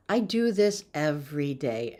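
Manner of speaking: ordinary delivery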